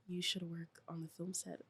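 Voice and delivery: low, hushed come-on, lightly voiced; mock his smooth low pitch, quietly; a hint of a sleazy flirt murmuring; imitating a flirty invite. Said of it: "He said it like that?".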